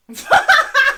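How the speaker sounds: loud, high burst of real laughter; real helpless laugh. Real, not acted. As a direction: crack up at herself. Laughing at forgetting her age.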